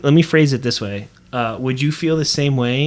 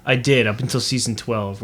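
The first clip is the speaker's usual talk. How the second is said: ordinary delivery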